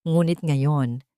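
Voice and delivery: ordinary delivery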